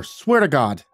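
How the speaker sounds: flustered, earnest protest; insistent. Acted. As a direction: plead to be believed. Son insisting on his innocence.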